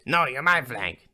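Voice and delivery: stubborn back-and-forth protest; acted bickering. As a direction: insist loudly, leaning on MY. Acted quarrel over flank.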